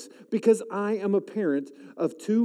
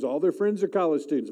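ordinary delivery

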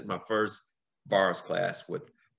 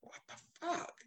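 whispered, high, much softer; hushed amazed swear; stunned whisper; 16 dB quieter than usual. Retold or imitated. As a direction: breathe it out in disbelief. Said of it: "I was like".

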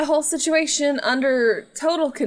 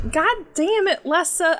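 high-pitched mock exasperation; exasperated scolding; 7 semitones higher than usual. Mentor trying to teach Lessa, high exasperated scolding. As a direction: scold in hammed-up frustration.

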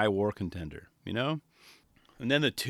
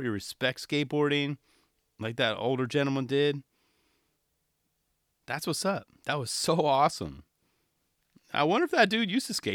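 normal talking voice

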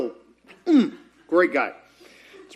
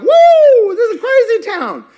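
excited; 9 dB louder than usual. Retold or imitated. Excited crowd voiced in sermon.

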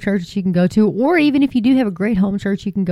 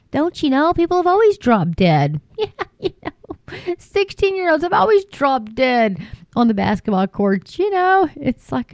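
mock dismissive; 7 semitones higher than usual. Mocking official dismissiveness.

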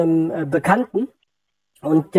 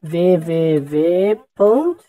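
slowly echoing while typing; slow repeating; much slower than usual. Real, not acted. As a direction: drag each letter out as you type. (langsam mittippend nachgesprochen)

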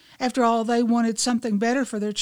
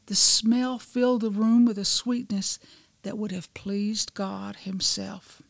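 usual speaking voice

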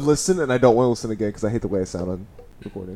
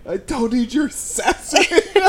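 laughing; 11 semitones higher, much slower than usual. Real, not acted. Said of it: "That's not sass".

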